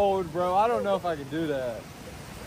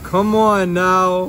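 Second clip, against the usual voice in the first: drawn out; 9 dB louder, much slower than usual. Real, not acted. Drawn out exclamation.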